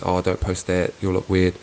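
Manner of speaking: ordinary talk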